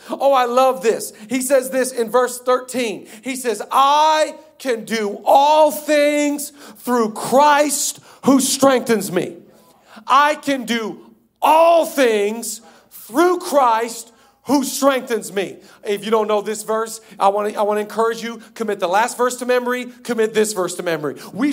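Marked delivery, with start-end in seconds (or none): 3.7-15.6 loud, high, very slow proclamation; declaimed scripture. Real, not acted. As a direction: stretch each phrase out with full conviction. Declaims the verse.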